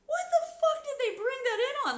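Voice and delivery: high, loud disbelieving shout; astonished yell. Retold or imitated. Streamer recalls asking about the dildo, high loud yell. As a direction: blurt it in outraged amazement. Own reaction retold, astonished.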